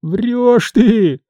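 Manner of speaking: high-pitched whine, acted; tearful voice. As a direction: wail back in disbelief, near tears. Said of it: "плачущим голосом отозвался Никишка". (плачущим голосом)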